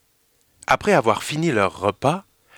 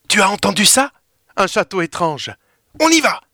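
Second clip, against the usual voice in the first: eager, higher-pitched call to adventure; bubbling with excitement, rising at the end; excited girl; 7 semitones higher, 3 dB louder than usual. Acted. Emma excitée par le château. (fillette tout excitée)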